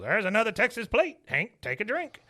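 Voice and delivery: lightly voiced cheerful driver; Topsy's line.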